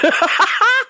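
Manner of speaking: high burst of laughter; laughing. Real, not acted. Cracking up at the school photos, high laugh.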